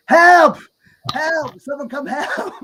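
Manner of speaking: high, loud panicked yell; shouting for help. Retold or imitated. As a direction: yell for help, half laughing. Friend collapsed, calling for help.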